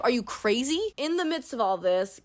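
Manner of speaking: usual speaking voice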